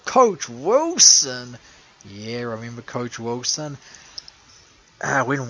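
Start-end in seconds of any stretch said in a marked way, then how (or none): 0.0-1.6 loud, drawn-out name with swooping pitch; mock-dread exclamation. Host naming the coach who makes skin crawl, loud mock dread. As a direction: stretch both words out loudly for effect.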